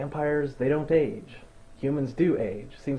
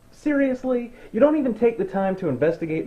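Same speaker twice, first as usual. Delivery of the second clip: incredulous; 8 semitones higher than usual. Real, not acted. Exasperated at character.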